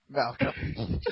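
ordinary talk, nothing marked

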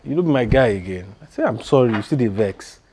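loud, pleading apology; exasperated apology. Acted. Friend begging still-vexed boss to relent, loudly exasperated.

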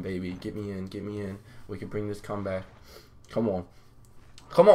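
ordinary talk

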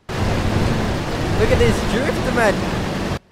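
slow, high showing-off cry; stretched excited drawl. Real, not acted. Gamer showing his mate a drift, slow excited drawl. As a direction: draw out the words, high and thrilled.

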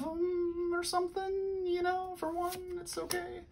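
high soft falsetto, fading away. Pretend. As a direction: squeak it out softly, high up. Falsetto aside on a card.

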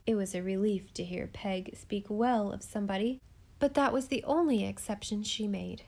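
plain reading voice

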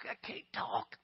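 choked-up whisper of a lump in the throat. Retold or imitated. Preacher showing the congregation a crying throat, choked whisper. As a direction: mimic a throat too tight to talk. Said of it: "you ever get that little thing stuck in your throat".

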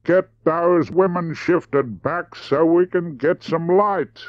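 high, very slow drawl; unhurried, deliberate drawl, set high. Acted. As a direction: draw every word out slowly, pitched high. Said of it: "said slowly".

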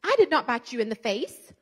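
loud, high, furious scolding; angry yelling at daughter. Retold or imitated. Mother yelling at her daughter, furious and loud. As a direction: yell it in her face, outraged. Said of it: "I stormed in that room".

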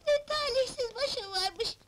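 slow, drawn-out self-pitying lament. Acted. Wife leaves rejected by her husband, lament. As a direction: bemoan your luck, stretching the words.

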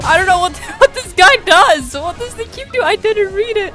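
high-pitched, panicky whining; flustered whine. Real, not acted. Player lost with a new character, whining in panic. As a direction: plead helplessly in a high voice.

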